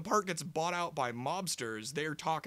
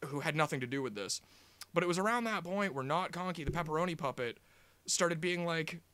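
usual speaking voice

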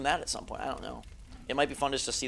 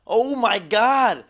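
loud, slow, high oh my god; real horrified groan of shock; creeped out; 7 semitones higher, 10 dB louder, much slower than usual. Real, not acted. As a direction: drag it out in disgust and fright. Reacting to slithering snakes.

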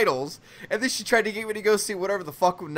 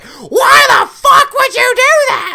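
high, drawn-out retold scream; slow, hammed-up shriek; enraged screech; 14 dB louder, much slower than usual. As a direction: mimic a furious girlfriend screaming down the phone. Girlfriend's angry reaction on phone.

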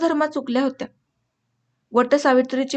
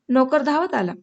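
plain reading voice

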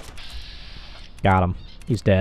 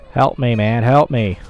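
usual speaking voice

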